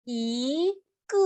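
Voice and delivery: Y gliding up, ge dropping down; rise then fall. Shown as a demonstration. Teacher drilling tones, Y rises and ge falls. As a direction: lift "Y", then let "ge" fall. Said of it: "sube y baja".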